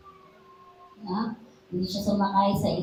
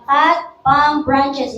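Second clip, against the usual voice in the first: "Cut palm branches" spaced out, slow and raised; slow emphatic command; 4 semitones higher, 6 dB louder, much slower than usual. Retold or imitated. Pastor quoting the verse's order to the church, slow and emphatic. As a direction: lay weight on every word of "Cut palm branches". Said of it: "sabi niya".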